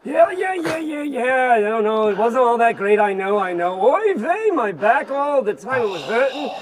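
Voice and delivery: put-on tired, complaining old camel voice; grumpy old camel. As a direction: moan about aching back and feet. Camel grumbles about the desert.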